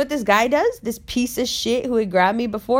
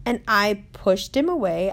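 ordinary talk

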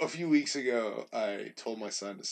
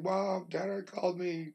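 put-on tattling kid voice; 7 semitones higher, slower than usual. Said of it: "he was like".

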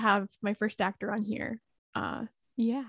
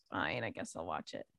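much lower and softer grudging give-in; low, quiet reluctant sigh of a line; grudging mumble; 13 dB quieter than usual. Retold or imitated. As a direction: sulk your way into agreeing. Reluctant giving in to friends.

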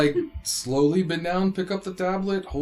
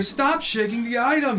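yelling; 9 semitones higher, 4 dB louder than usual. Acted. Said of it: "You literally hear".

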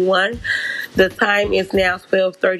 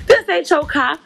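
higher, pushier repeat of the callout; talking shit louder; 8 semitones higher than usual. Retold or imitated. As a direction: press harder on the accusation. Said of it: "So now I'm talking shit".

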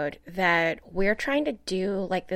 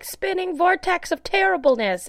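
panicky; 10 semitones higher than usual. Retold or imitated. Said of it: "'cause I'll be like".

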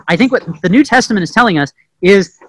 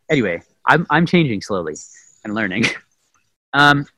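ordinary talk

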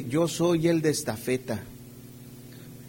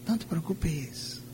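even softer, fading coax; the enemy's whisper, softer; 10 dB quieter, slower than usual. Acted. A preacher repeating the enemy's lie even softer to the flock. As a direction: let the lie die away to almost nothing. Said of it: "una de sus frases que es".

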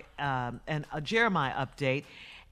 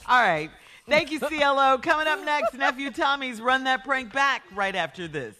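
high, loud radio host hand-off; radio announcer tease; 8 semitones higher, 6 dB louder than usual. Acted. Host sends listeners to the next prank segment, radio announcer tease. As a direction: punch up the coming segment with lifted energy.

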